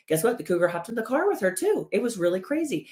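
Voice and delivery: animated body voice. Acted. Body reporting the cougar in the car, mock amazed.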